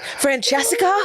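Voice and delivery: high questioning mock-polite voice; imitating spoiled girl. Spoiled girl trying not to seem spoiled, high mock voice. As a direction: pitch it up, feigning politeness. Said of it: "act like they weren't spoiled and be like".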